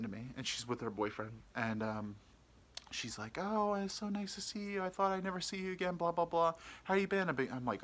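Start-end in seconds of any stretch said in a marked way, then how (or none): 3.4-7.3 much higher, sugary greeting; gushing woman. Retold or imitated. Woman greeting her one-night stand, high and gushy. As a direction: gush like you're thrilled to see him.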